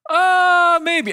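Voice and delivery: high, loud, drawn-out hesitation; hesitant maybe. Retold or imitated. Waitress hesitant answer.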